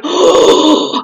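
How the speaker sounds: big drawn-out gasp of amazement; re-enacted gasp. Driver sees skeleton emblem on the car ahead, acted amazed gasp. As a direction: gasp as if spotting a treasure. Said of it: "And I'm like".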